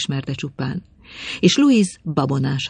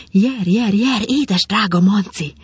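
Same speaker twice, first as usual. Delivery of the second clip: high, loud eager calling; joyful cry; 6 semitones higher, 4 dB louder than usual. Acted. Madame summoning her lover up from the garden, joyful cry. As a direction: call him up, overjoyed. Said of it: "kiáltotta Madame Louise".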